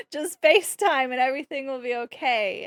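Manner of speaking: loud, laughing through the joke. Real, not acted. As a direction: giggle the line out.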